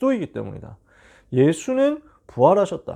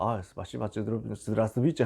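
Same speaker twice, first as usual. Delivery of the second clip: a softer mock Russian babble; imitating Russian speech, softer; 6 semitones lower, 7 dB quieter than usual. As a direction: mumble fake Russian under the breath. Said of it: "갑자기 러시아어가 터져".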